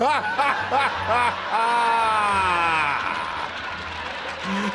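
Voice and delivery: words broken by laughter; laughing. Real, not acted. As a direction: laugh through the line. Laughing at a chat joke.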